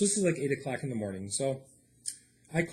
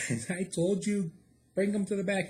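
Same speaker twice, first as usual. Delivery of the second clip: chewing him out; 7 semitones higher than usual. Retold or imitated. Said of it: "I'm chewing out this nine one one guy".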